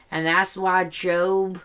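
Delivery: slow, mock Southern Bible talk; Southern accent parody. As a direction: draw it out like a pious Southerner. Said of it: "he has this weird southern accent".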